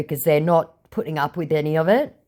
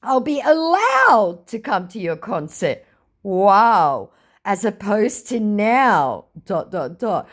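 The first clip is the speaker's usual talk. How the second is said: slow, dry mock amazement; sarcastic; 6 semitones higher, slower than usual. Mocking a scammer's offer, slow and sarcastic. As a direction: drag it out, dripping sarcasm. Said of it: "To which I went".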